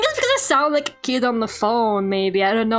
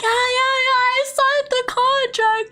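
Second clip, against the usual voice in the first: much higher, pleading and sweet; pleading customer service voice; 12 semitones higher than usual. Retold or imitated. Begging the agency to believe she got the job, sweet high pleading. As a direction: beg them to believe you, sweetly. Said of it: "my customer service voice".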